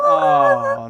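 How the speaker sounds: high, loud "oh"; a comic wail of dread; mock dismay. Boater reliving the moment tires start spinning, dismayed.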